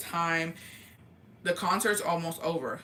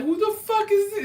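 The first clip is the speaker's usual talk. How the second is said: high, loud mock-startled exclamation; retold shocked yelp; startled outburst; 8 dB louder than usual. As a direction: blurt it out high, imitating someone caught off guard. Acting out friend's startled reaction.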